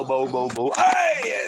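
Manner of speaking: high, loud playful yelp at the dog; excited dog talk. Real, not acted. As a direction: rile the dog up with a sudden high shout. Playing with dog.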